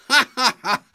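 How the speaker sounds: high, loud hearty laugh; big tiger laughing at the bleating cub; amused laugh. A narrator's reading. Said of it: "said the big tiger with a smile".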